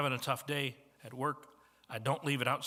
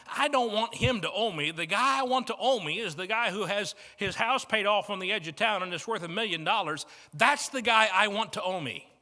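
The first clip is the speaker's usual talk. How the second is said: louder, higher, pressing the point home; emphatic preaching; 5 semitones higher, 6 dB louder than usual. Real, not acted. Pastor to congregation, rising emphatic preaching.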